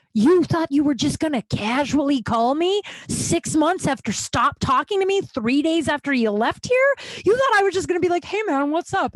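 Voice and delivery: high, incredulous acted rant; indignant outburst. Storyteller acting out berating the ex, indignant and high. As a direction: confront him, voice pitched right up.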